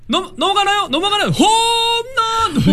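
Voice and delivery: soaring, high-pitched home run call; sports caster. Shown as a demonstration. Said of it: "캐스터처럼?".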